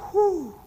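big loud sigh of relief; relieved whew. Retold or imitated. A saver finally gets it, loud relieved whew.

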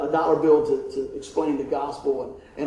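ordinary talk, nothing marked

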